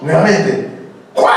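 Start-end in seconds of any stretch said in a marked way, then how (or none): 1.2-1.3 put-on parent calling child.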